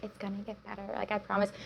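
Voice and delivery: soft, slightly sing-song platitude; mock cliché reassurance. Guest parodies the cliché she told herself, mock reassurance. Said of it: "it sounds really cliche".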